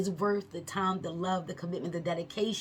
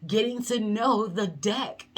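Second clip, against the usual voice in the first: laughing; 4 semitones higher, 5 dB louder than usual. Real, not acted. Laughs mid sentence.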